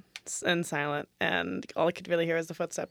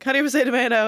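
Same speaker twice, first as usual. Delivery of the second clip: eager icebreaker question; 5 semitones higher, 6 dB louder, faster than usual. Acted. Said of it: "but I'll be like".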